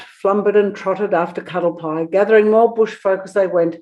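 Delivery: usual speaking voice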